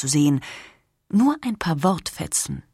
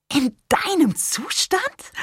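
high, slow shriek of disbelief; shrieked question; 10 semitones higher, slower than usual. Acted. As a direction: squeal it out in shock, drawn out. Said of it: "kreischte Irma".

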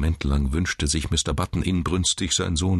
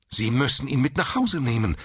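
higher-pitched, firm insistence; insistent nurse; 9 semitones higher than usual. A narrator's reading. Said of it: "beharrte die Schwester". (beharrlich und streng)

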